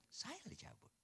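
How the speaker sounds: high breathless whisper; whispered plea. Retold or imitated. Husband praying not to outlive his wife, whispered high. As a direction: breathe it out as a half-joking plea. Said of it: "Tuhan, tolonglah kau konsisten".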